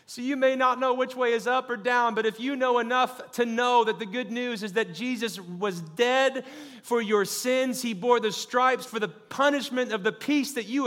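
rising pulpit fervor; preaching at full force. Acted. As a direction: drive it home to the congregation.

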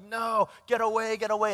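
retold distress, only mildly acted; nightmare cries. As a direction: push someone away in a bad dream. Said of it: "Manny was having nightmares".

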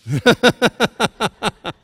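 high, loud, long laugh; amused laugh. Real, not acted. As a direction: laugh out loud at your own joke. Amused by the verse he read.

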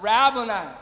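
loud cry of recognition; calling out. Acted. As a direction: call out the name. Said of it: "as she called out".

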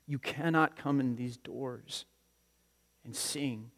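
ordinary delivery

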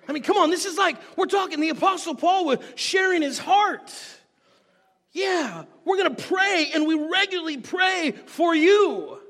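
high, impassioned pulpit delivery; fervent preaching. Real, not acted. Preacher telling the church we pray for you, fervently. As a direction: preach it out with rising force.

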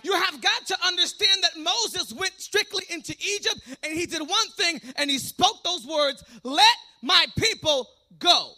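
loud, driving sermon delivery; full-force preaching. Real, not acted. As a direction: preach it to the rafters.

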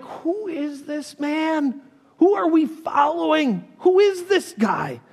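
high, slow, amazed question; stunned disciples asking who Jesus is; awed disbelief. Acted. Awed disciples after the storm.

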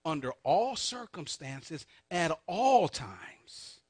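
ALL stretched out in "all circumstances" and "all times"; emphatic slow stress. Shown as a demonstration. Preacher drives the confession home, slow stress on "all". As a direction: lean long and hard on each "all".

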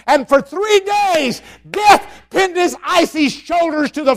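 loud, high, slow triumphant preaching; preaching at full force. Acted. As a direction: build to a shouted climax. Builds to resurrection climax.

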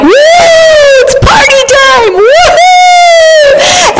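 very high whooping cheer; party whoop. Retold or imitated. Mother recalls the family celebrating in the waves, whooping high. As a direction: shriek it like a kid at the beach.

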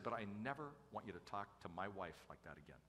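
soft, firm fatherly warning; not yelling. Retold or imitated. Father defending his wife to daughter, firm but quiet. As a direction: lay down the rule quietly. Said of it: "I wasn't yelling at her".

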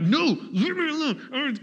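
dad brushing off his nagging daughter, exasperated; annoyed dad. Retold or imitated. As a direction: whine back like a fed-up parent. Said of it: "'cause I'm such a great parent".

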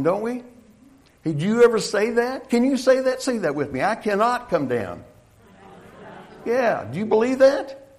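high, loud, emphatic pulpit delivery; rally the congregation to say it along; rousing preaching. Real, not acted. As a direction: preach it hard, pitch climbing.

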